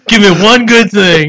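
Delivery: high, loud laughing plea. Real, not acted. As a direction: crack up while talking. Laughing at the challenge.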